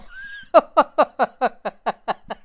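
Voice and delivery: a long laugh fading out; trailing laugh. Real, not acted. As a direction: laugh it off at the end. Laughs after no girlfriends.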